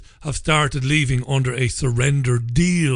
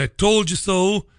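"I told you so" lifted high, left hanging; quoted catchphrase; 5 semitones higher than usual. Shown as a demonstration. Quoting the phrase before owning it.

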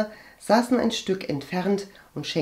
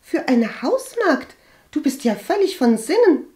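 high, loud, aghast disdain; incredulous scoff; 10 semitones higher, 6 dB louder than usual. A narrator's reading. Cousin ridicules calling doctor for a maid, scoffing. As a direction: scoff in high-pitched disbelief.

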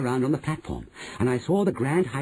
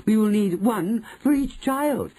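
high, drawn-out crowd voice; crying out; 9 semitones higher, 3 dB louder, slower than usual. A narrator's reading. Witches objecting to their leader, high cry. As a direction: insist slowly at high pitch. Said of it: "cried the audience".

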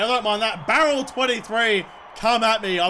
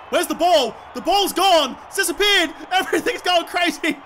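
high, raised-voice alarm; frantic gamer confusion; bewildered excitement; 6 semitones higher than usual. Real, not acted. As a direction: call out in mounting disbelief. Bewildered as ball vanishes.

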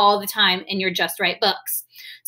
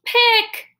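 really loud; 12 semitones higher, 3 dB louder than usual. Shown as a demonstration. Teacher tells the class to say Pick loudly, shouted. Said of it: "Now say it really loud".

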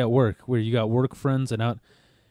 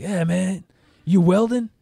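lightly voiced higher-pitched pimp-like coworker; mildly put-on movie pimp voice; Pinky; 6 semitones higher than usual. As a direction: imitate a chatty coworker, pitched up. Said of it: "He sounds exactly like Pinky".

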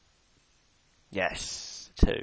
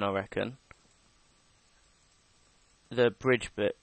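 usual speaking voice

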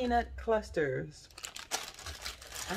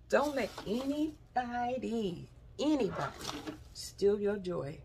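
slow emphatic. Acted. Closing motivational advice to viewers.

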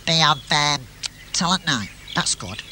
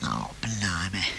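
whispered; 12 semitones lower, 11 dB quieter, slower than usual. Acted. Dismayed reaction.

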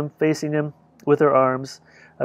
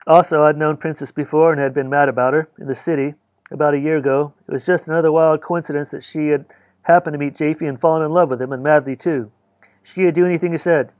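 plain reading voice

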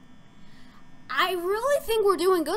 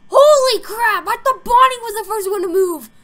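raised, loud burst of alarm; shocked exclamation; 5 semitones higher, 8 dB louder than usual. Real, not acted. Player caught out by the game, loud shock. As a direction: cry out, thrown by what you see.

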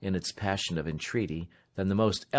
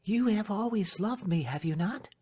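high, pleading voice; Marian, agitated young lady; 12 semitones higher than usual. Acted. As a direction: appeal anxiously to a trusted servant, high-pitched.

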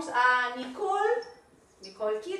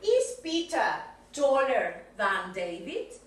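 slow model question; slower than usual. Shown as a demonstration. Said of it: "quiero que me hagas una question".

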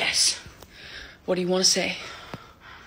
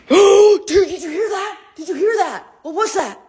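excited alarm; 10 semitones higher, 6 dB louder than usual. Real, not acted. After a noise in the haunted room.

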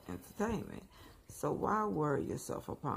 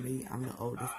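ordinary talk

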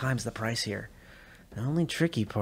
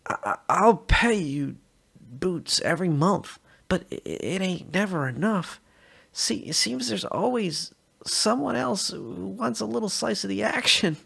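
mocking whiny voice; 8 semitones higher than usual. Officer mocks a bribe-taker.

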